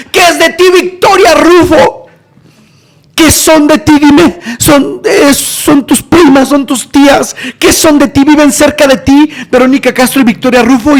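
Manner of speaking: high, loud, overwrought imitation; frantic questioning. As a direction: pepper them with desperate questions. Retold frantic interrogation of speaker.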